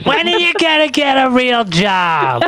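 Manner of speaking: high mocking mom voice; mom GPT. Playing a roasting mom asking about a real job, high voiced. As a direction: nag like a disappointed mother. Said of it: "Mom GPT".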